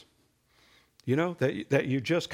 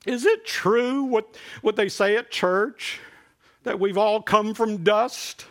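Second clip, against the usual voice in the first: put-on higher, louder, slow worried child voice; little boy asking; 7 semitones higher, 5 dB louder, slower than usual. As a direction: play the small boy asking his mother, slowly and up high. Boy asks mother in joke.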